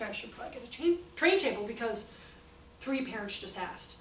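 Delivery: ordinary delivery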